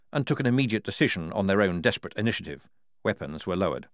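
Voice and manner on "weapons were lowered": usual speaking voice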